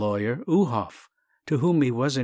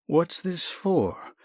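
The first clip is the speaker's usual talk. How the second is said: slow, raised puzzled query; puzzled question; 7 semitones higher, much slower than usual. Acted. Doctor eyeing the parcel, slow puzzled question. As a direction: ask slowly, baffled by the parcel.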